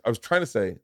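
ordinary delivery

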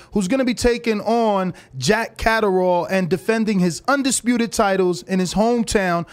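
usual speaking voice

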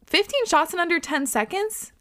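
high, rising question of disbelief; incredulous. Real, not acted. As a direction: echo it as a shocked question. Repeats it in shock, not safe.

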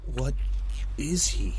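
high, halting question; fearful question. Acted. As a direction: ask haltingly, in dread. Character asks in dread.

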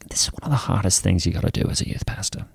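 breathy confiding whisper, quick; hushed whisper. Retold or imitated. Youth pastor whispering to a scared young couple, hushed. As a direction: lean in and whisper it like a secret.

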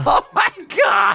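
high, loud laughing cry; laughing shriek. Real, not acted. Shrieking at the joke.

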